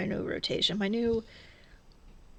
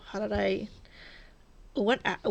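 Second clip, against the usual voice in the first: ordinary delivery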